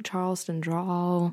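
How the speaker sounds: slow, lightly put-on Southern drawl; Southern Charleston drawl. Shown as a demonstration. Boasting of dialect training in a Charleston drawl. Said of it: "got all these dialect trainings".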